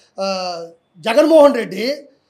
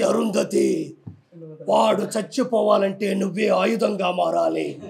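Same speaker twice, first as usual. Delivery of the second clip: much lower, slow and solemn, like an oracle's pronouncement; retelling the Arundhati film's sage, deep slow solemn voice; film sage's voice; 4 semitones lower, slower than usual. Retold or imitated. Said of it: "అదంతా చూసి".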